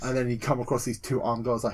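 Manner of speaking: ordinary talk, nothing marked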